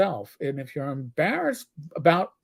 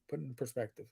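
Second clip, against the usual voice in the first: ordinary talk, nothing marked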